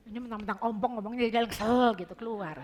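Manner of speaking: loud amused aside; laughing loudly. Real, not acted. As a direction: joke through a laugh.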